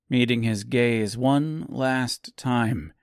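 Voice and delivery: usual speaking voice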